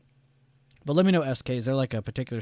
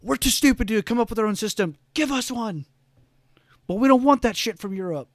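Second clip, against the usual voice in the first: high, loud put-on dumb voice with a whispered middle; mocking caricature of Americans, pitched way up; 11 semitones higher, 9 dB louder than usual. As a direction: ham up clueless Americans begging for a system. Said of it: "We're like".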